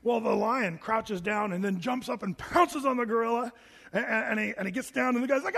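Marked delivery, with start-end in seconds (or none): none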